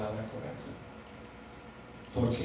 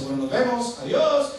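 mocking goodbye; 11 semitones higher, 12 dB louder than usual.

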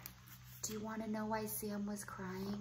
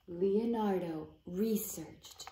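ordinary delivery

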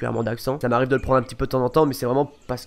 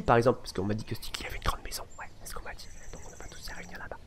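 conspiratorial whisper; 3 semitones lower, 12 dB quieter than usual. Real, not acted. Whispering a rumour to viewers about Sticky's house. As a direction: lean in and whisper the secret. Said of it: "conspiratorial whisper aside".

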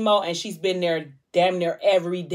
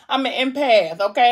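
laughing and higher, louder; 5 semitones higher, 6 dB louder than usual. Real, not acted. Telling viewers she is an empath, laughing. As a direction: laugh it off, playfully defensive. Laughs at her own emotion.

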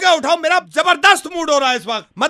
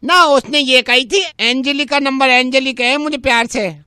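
Angelica; 3 semitones lower than usual. Acted. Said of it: "Angelica's coming".

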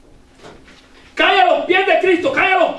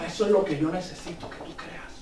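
low soft voice; 8 semitones lower, 13 dB quieter than usual. Retold or imitated. Lord's words voiced low.

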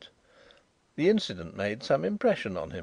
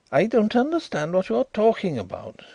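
high, wary denial; guarded; 10 semitones higher, 5 dB louder than usual. A narrator's reading. Visitor denies to Carrados, guarded and high. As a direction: hold it back, guarded. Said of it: "said Drishna guardedly".

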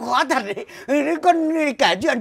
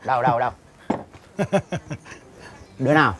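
low bully voice; 6 semitones lower, faster than usual. Acted. Bully demands who made the dare.

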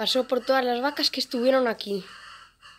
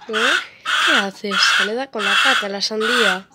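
scolding; 8 dB louder than usual. Real, not acted. Riñe al animal.

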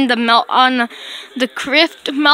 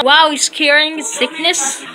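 ordinary delivery

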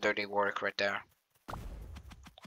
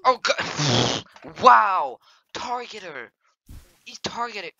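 high outraged yells; outraged exclaiming; 10 semitones higher, 5 dB louder, slower than usual. Real, not acted. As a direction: cry out at the betrayal. Outrage at being targeted.

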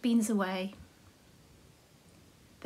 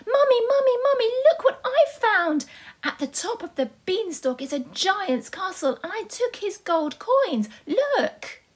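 put-on much higher, louder, excited child voice; excited little Jack; 10 semitones higher, 4 dB louder than usual. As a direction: burst in thrilled to show mum. Jack runs home excited.

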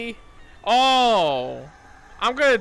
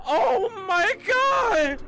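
despairing wail; 14 semitones higher, much slower than usual. Real, not acted. Despair at added monster.

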